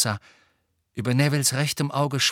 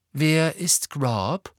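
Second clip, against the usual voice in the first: slow, higher curious question; interested; 5 semitones higher, 3 dB louder, much slower than usual. A narrator's reading. Luna hearing an unknown name, interested. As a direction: ask with open curiosity. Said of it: "fragte Luna interessiert".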